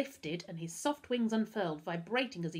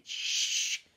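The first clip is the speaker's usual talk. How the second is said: long, hissed "shh"; urgent shush; 3 dB louder than usual. A narrator's reading. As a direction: hush someone who is shouting. Alarmed friend hushes the shouting.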